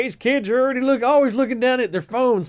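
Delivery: high, loud mock boomer complaint; grumbling baby boomer. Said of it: "sounds like a baby boomer that's like".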